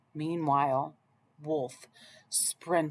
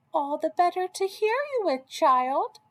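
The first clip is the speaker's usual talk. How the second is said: high, quick mock-granny voice; the wolf faking a kindly old lady; wolf as Grandma; 10 semitones higher than usual. Wolf in Grandma's bed answering Red, a high fake-granny voice.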